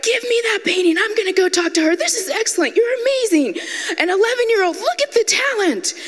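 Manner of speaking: high-pitched, hammed-up outrage; indignant proud mother. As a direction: rave indignantly like an offended stage mom. Mother acts outraged, praising child's work.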